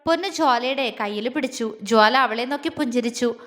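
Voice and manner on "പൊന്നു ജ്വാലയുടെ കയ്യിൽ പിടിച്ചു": ordinary delivery, nothing marked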